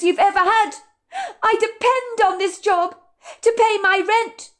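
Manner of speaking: high, pleading, near tears; a waitress begging her manager, near tears; tearful pleading. Acted. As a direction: beg him not to fire you. Madison pleads for her job.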